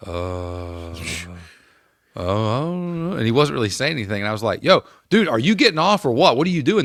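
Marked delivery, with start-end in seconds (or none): 0.1-3.2 lightly voiced dazed teammate, unsure; spaced-out stoner. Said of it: "And he was like". 4.6-6.8 higher, louder call across the ice; frustrated captain calling out; retold yell. Yelling at teammate at bench.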